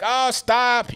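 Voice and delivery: high, breathy-free mock plea of oh stop; mock natural victim. Shown as a demonstration. Showing how a natural kidnap victim sounds, lightly acted high plea. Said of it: "You gotta be like".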